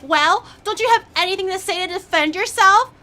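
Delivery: hammed-up impatient boyfriend asking for a defence; a mocking take on an annoyed partner; imitating boyfriend. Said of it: "he was like".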